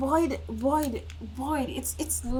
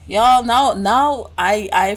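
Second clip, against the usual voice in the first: calling out; 9 dB louder, slower than usual. Real, not acted. Loud call to viewers.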